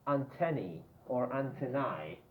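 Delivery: first antennae as an-TEN-ee, second antennae as AN-ten-eye; two pronunciations. Shown as a demonstration. Teacher contrasts the two antennae.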